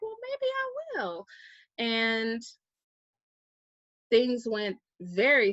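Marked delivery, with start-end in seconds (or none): 0.0-1.3 lilting, much higher, playful maybe; coy maybe. Retold or imitated. To a friend urging her to move, a high playful maybe. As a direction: tease out a coy, airy maybe.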